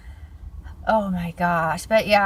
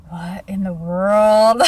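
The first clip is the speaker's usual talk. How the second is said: stunned disbelief; 6 dB louder, much slower than usual. Retold or imitated. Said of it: "I was just like".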